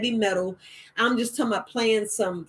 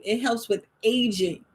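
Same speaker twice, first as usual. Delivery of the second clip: normal talking voice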